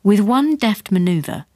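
plain reading voice

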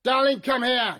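high, loud command; loud order. Acted. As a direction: call her over hard. Barking order at robot.

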